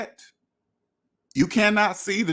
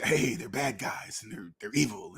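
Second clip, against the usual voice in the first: spooky warning voice; 8 semitones lower, 9 dB quieter than usual. Retold or imitated. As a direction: drop into a hushed bogeyman tone. Mocking fearmongering talk about the IRS.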